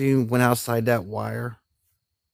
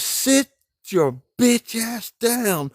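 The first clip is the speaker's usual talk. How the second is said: higher, slow, hammed-up order from a fed-up sergeant; mock angry snap; 10 semitones higher, much slower than usual. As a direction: imitate a sergeant who has had enough. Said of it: "just snapped".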